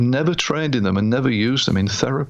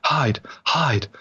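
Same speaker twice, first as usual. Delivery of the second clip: hushed, higher mock shout; retold shouting out; 6 semitones higher, slower than usual. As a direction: cry out urgently without real volume. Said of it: "he was shouting out".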